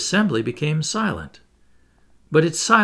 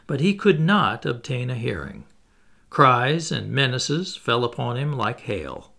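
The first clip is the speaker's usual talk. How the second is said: plain reading voice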